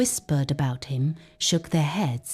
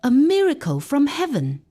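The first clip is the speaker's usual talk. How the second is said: higher, quick burst of joy; amazed exclaim; 8 semitones higher, 3 dB louder, faster than usual. Acted. Father marveling at his healed family, excited and high. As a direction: blurt it out in wonder.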